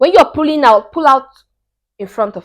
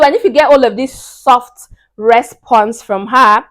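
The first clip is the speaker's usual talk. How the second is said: ordinary talk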